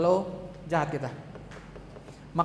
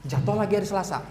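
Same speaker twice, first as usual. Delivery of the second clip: ordinary delivery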